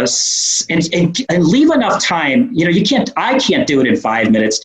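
ordinary delivery

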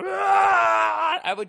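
long, high, very loud drawn-out yell; mock scream. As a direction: scream like you're trapped in the turret. Imagining screaming in turret.

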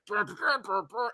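high buzzing trumpet toots; imitated trumpet. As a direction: mimic short trumpet blasts with the lips. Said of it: "starts playing the trumpet".

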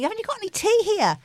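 high, quick plaintive asking; imitating herself pleading for tea, high and fast; retold asking. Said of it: "walking around saying".

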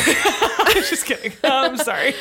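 high laughing apology. Real, not acted. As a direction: laugh through the words. Laughs at her own joke.

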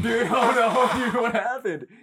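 laughing, high-pitched sympathy; real laughter breaking into the words. Real, not acted. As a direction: crack up while asking about their day. Friend laughs at complaint.

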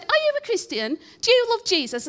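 put-on high, loud Blackpool accent; the broad Blackpool patient, pitched high and loud. Blackpool lady on the ward quizzing her, loud Blackpool accent. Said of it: "she talked like that 'cause she's from Blackpool".

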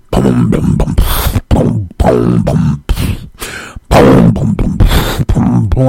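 deep booming mouth beat; imitated music beat. Rapper's music comes on, imitated low beat. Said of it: "Your music come on".

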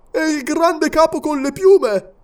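high, eager, rough question; hungry for a kill; bloodthirsty servant. A narrator's reading. Servant asking his king about the governor, eager.

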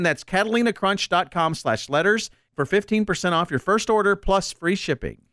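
upbeat sponsor read, brisk and bright. Acted. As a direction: sell it like a radio spot. Cohost reads sponsor ad.

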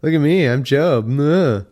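loud, high, dopey mock voice; dumb voice. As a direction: play the fool making fun of Job. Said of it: "in a dumb voice".

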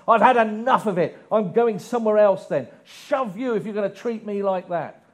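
loud, aggrieved rant, half-hearted pretend; mock indignant outburst. Preacher voicing the woman who never said this, mock-angry rant. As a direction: vent the grievance, pretend outraged woman. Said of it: "She doesn't say".

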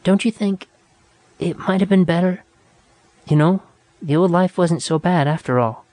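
high, small trembling plea; a frightened girl's thin voice. A narrator's reading. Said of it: "a thin voice trembled".

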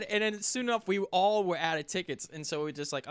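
ordinary talk, nothing marked

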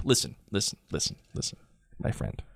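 very soft, near-whispered aside; hushed mock warning. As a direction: drop to a confiding murmur, in jest. Hushed aside mocking New York accent.

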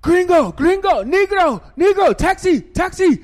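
very high, loud calls of street touts; shouting taxi touts mobbing an arriving traveller; hawking taxi drivers. Retold or imitated. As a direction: holler for customers like pushy taxi hustlers. Taxi touts calling at airport.

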